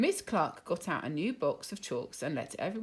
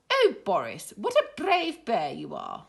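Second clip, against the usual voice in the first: smiling; 3 semitones higher, 6 dB louder than usual. Acted. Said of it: "smiled Miss Cluck".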